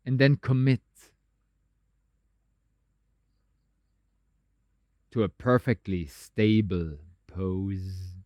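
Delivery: guided meditation. Acted. Teacher settling meditators into a stable pose, calm guided meditation.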